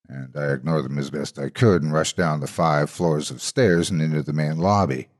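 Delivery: plain reading voice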